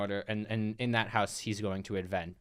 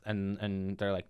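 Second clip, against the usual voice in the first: usual speaking voice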